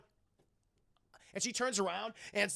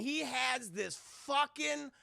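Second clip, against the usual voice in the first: ordinary delivery, nothing marked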